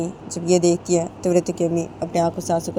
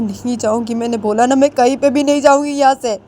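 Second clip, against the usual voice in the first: louder, higher defiant refusal; stubborn refusal; 6 semitones higher, 4 dB louder than usual. Acted. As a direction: refuse flatly. (जाने से साफ़ इनकार, ऊँची तेज़ आवाज़)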